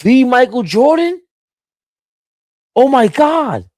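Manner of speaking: high, loud freaking-out voice; a kid freaking out on the phone; high, loud starstruck squeal. Retold or imitated. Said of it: "I'm like".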